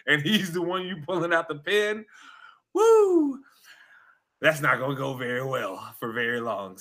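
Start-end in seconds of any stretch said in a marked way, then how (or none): 2.7-3.4 loud, high "woo"; sharp falsetto-high exclamation; high whoop. Real, not acted. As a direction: let out a high-pitched whoop. Exclaims over a doubtful move.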